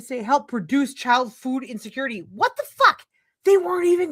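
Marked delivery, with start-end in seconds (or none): 2.3-4.1 high, loud incredulous what the fuck; outraged disbelief. Real, not acted. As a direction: spit it out in disbelief. Reacting to bill text in outrage.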